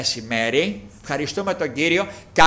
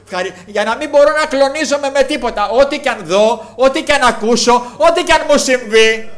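higher and louder, builds to full-throated preaching; fervent preaching shout; 8 semitones higher, 8 dB louder than usual. Real, not acted. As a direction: proclaim it to the whole congregation, voice soaring. Sermon climax, rising fervour.

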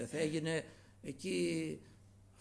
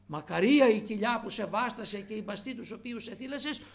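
raised, higher voice, imitating a shout; loud calling out; 7 semitones higher, 7 dB louder than usual. Preacher quoting the woman who cried out from the crowd, loud calling. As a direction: call out the blessing loudly. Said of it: "να φωνάζει δυνατά".

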